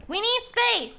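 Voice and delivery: high, loud cry; mock demanding shout. Streamer digging out room for the build, shouting. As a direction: call it out insistently, pitch leaping. Same words repeated as shout.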